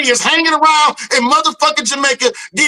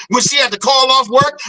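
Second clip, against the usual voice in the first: usual speaking voice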